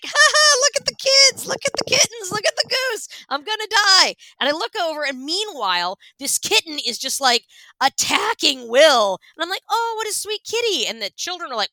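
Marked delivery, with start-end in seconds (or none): 0.0-4.1 much higher, louder strained chirp; high panicky mock-cheerful babble; nervous fake cheer. 9.7-10.9 higher sweet coo; baby-talk coo over a kitten. Retold or imitated.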